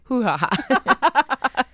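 high, slow laughing Hoo-ha-ha; mock Hoo-ha-ha laugh. Echoing her friend's hoot, a slow high laughing Hoo-ha-ha.

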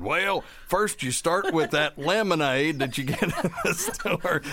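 quoting through laughter; laughing. Retold or imitated. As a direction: giggle through the quote. Retells boyfriend, laughing.

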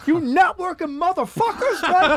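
very high, loud imitation of a furious rant; flaming everybody. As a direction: lay into the whole room, mock-enraged. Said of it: "He starts just flaming everybody".

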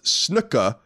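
snooka, said with a dropped r ending in uh; British pronunciation. Retold or imitated. Listener letter, British way of saying snooka. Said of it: "Snooker is pronounced snooka".

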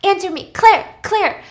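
high, acted frantic calling; urgent pleading call. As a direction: plead for an answer through a door. Virgil slams on door, frantic.

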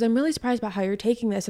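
fast, run-together imitation of his line; quick retelling. Repeats the ex's line quickly.

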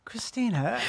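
British "Christina" rising like an invitation; upper-crust British. Acted. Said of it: "With an E R".

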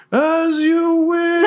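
high, stretched-out comic yell. Acted. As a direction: belt it slowly into the mic with a laugh. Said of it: "he just yells into the thaumaturgical mic".

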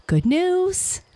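high, rising, eager greeting; excited announcement. Acted. Fox bringing his friend good news, excited. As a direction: sing out the news, climbing high.

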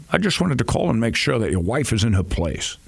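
the Connery brogue, needling; Sean Connery impression. Prank call to Connery, Scottish mock voice. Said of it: "I did both voices".

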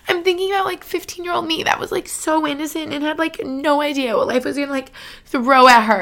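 high, tearful voice; holding back real tears; words tight with emotion; choked up. Real, not acted. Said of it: "already wanting to cry".

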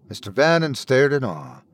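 usual speaking voice

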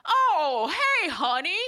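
hammed-up sheepish husband admitting she was right; husband caving. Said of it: "he's like".

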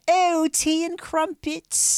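very high, drawn-out mock-posh British; posh English accent. American's stock impression of every Briton, posh English accent. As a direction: caricature a posh Brit, high and slow. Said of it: "Americans do a posh English accent".

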